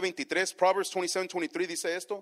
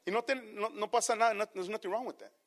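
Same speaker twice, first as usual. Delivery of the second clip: normal talking voice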